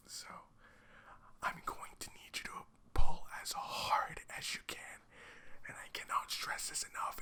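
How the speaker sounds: hushed, breathy parody of an ASMR host greeting a customer; mock ASMR whisper. YouTuber mocking ASMR gaming videos in a hammed-up whisper. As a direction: whisper it like a creepy shopkeeper sharing a secret. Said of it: "the very whispery voice to titillate".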